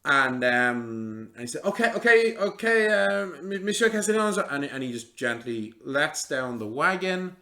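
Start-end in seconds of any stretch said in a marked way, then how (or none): 1.6-4.4 much higher, louder acted call; hurried alert. Helper telling Monsieur the wheel is on, high raised call. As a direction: get the lifter's attention, voice raised high. Helper reacts to held-up wagon.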